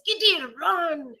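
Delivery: loud, slow, high shout to flee; urgent warning cry. Acted. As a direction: yell for the kitten to run. Mother cat warns her kitten.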